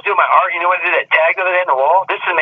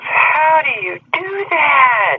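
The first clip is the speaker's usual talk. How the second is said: put-on high, slow, amazed little-girl voice; mimicking granddaughter; 12 semitones higher, much slower than usual. Granddaughter marvels at grandpa's drawing, high slow childlike voice. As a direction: stretch it out like an awed kid.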